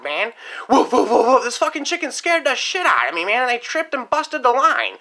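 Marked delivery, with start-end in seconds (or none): 0.7-1.4 short barks, mock dog woof; imitated dog barking. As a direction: bark like a chicken pretending to be a dog. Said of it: "the fucking chickens were barking at me like a dog".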